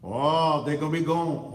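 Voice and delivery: brief teasing fake Chinese accent; mock Chinese accent. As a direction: toss off a joking Chinese accent. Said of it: "Oh, that's my Chinese accent".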